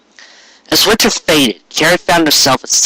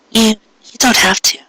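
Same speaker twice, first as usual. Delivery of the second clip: much higher, stammering shy mare's voice; flustered Cupcake; 10 semitones higher than usual. Acted. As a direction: ask shyly, tripping over the words.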